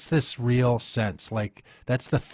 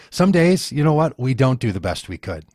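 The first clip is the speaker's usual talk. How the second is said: louder and higher push; emphatic; 4 semitones higher, 7 dB louder than usual. Real, not acted. Pressing his point on the host, louder and higher. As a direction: raise it to drive the point home.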